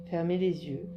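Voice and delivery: ordinary talk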